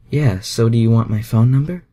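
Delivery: high, loud, teasing female voice; a playful Southern belle asking; flirty Rogue. A narrator's reading. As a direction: tease him high and bright. Rogue character dialogue.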